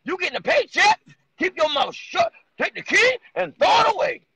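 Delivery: high, slow mocking voice; mocking Steve Harvey. Cohost parodies how Steve Harvey scolded Monique, high and slow. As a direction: ham up a bossy sellout hushing her. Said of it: "the way he said it was more like".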